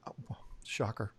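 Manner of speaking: whispered, slow sarcastic aside. Real, not acted. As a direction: mutter it under your breath with irony. Sarcastic aside about the filters.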